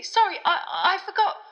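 high, stammered, flustered excuse; hasty apology. A narrator's reading. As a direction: backpedal in a fluster. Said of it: "said Harry hastily".

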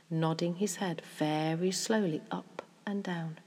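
usual speaking voice

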